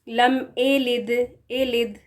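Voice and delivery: لم اے لد with ya turned into a glottal e sound; slowly says اے لد, dropping the ya; wrong reading demo. Shown as a demonstration. Teacher shows students the common mistake. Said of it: "تو یہ غلط ہے".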